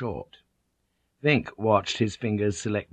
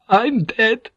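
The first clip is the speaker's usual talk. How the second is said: very high, loud hysterical cry; hysterical shriek; 14 semitones higher, 6 dB louder than usual. A narrator's reading. Doomed Vink telling the others he's dead, hysterical shriek. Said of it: "Vink shrieked with laughter".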